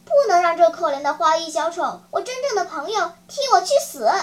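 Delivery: ringing, quick heroic declaration; stirring speech of self-sacrifice; bold and impassioned. Acted. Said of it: "声调豪迈激昂".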